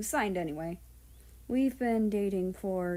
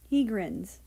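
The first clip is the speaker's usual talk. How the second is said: ordinary delivery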